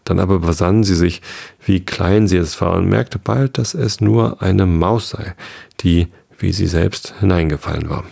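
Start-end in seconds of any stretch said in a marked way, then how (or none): none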